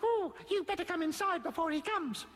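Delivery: high-pitched wee goblin; little tree goblin. A narrator's reading. Tree goblin warning children about the lion, little goblin voice. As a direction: urge them along in a tiny high voice.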